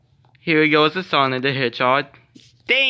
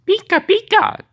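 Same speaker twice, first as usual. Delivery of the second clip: high squeaky Pikachu cry; 5 dB louder than usual. Pretend. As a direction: squeal it like the little yellow creature. Playing Pikachu toy voice.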